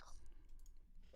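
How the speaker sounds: long breathy fed-up sigh; exasperated sigh. Pretend. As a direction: sigh like you've had enough. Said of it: "have an exasperated sigh".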